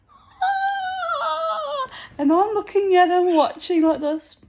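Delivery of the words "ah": high piercing imitated scream; mock scream. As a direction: shriek it like the guy in the club. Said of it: "he's screaming like this".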